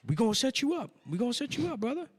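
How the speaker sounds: high-pitched hustler character; high comic impression of a smooth-promising investor; put-on investor voice. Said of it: "why is he talk that way".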